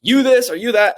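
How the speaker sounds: high, loud mock outburst; imitating an angry rant, high and loud; mock lashing out. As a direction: snap back like an offended mentee. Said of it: "lash out".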